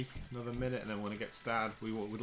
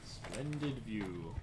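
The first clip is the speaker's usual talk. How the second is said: slow, drawn-out mock narrator; posh narrator imitation; 2 semitones lower, 6 dB quieter, much slower than usual. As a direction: mimic the game's narrator, slowly. Echoing game narrator slowly.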